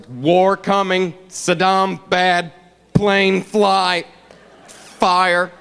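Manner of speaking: very slow caveman phrases; halting, one simple word at a time; Bush. Retold or imitated. President speaking first, slow halting simpleton delivery. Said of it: "when Bush has to go first".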